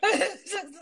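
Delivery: high, loud laughing words; words breaking up with laughter; real laughing. Real, not acted. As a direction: try to carry on through laughter. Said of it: "I'm sorry, I'm laughing".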